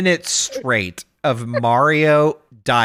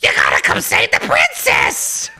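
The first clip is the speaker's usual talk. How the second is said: Toad; 11 semitones lower than usual. Retold or imitated. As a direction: imitate a frantic squawking sidekick. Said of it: "Toad showing up out of nowhere".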